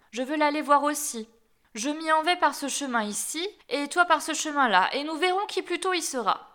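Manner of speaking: scheming wolf. A narrator's reading. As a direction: propose the race as the wily wolf. Wolf proposes a race.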